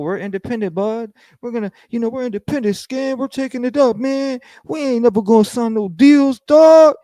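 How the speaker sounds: mocking tough-guy brag climbing high and loud; mock tough boast. Mocking the rivals' independence boast to the chat, rising shout.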